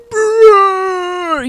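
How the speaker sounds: loud high lip-trilled bear growl. Retold or imitated. Bear daughter mad about the plate, growling loudly. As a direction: roar out a long growl with flapping lips. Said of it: "like a bear growling".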